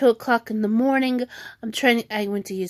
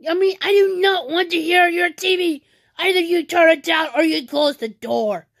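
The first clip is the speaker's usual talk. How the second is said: higher-pitched, exasperated scolding; retold angry yell; 7 semitones higher, 3 dB louder than usual. Sister telling brother off over the TV, retold exasperated yell. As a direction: lay down the law in a raised pitch. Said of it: "I s-, yelled at him saying".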